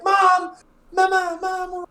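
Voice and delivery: high, loud calls for mom; kids calling out. Acted. Children calling to their mother from the street, high loud shouts. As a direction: yell for mama like lost kids.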